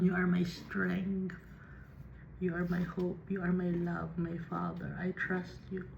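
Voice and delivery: soft, hushed words of devotion; trusting prayer. Acted. Voicing a trusting prayer to God. (tahimik at mataimtim na dasal)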